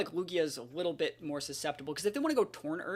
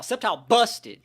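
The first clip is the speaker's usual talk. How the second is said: loud, higher Sceptile busted; hyped call; 5 semitones higher, 8 dB louder than usual. Real, not acted. Player bragging about Sceptile to viewers, loud. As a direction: punch it out with hype. Hyping his Pokémon.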